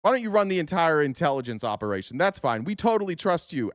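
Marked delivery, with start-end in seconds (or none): none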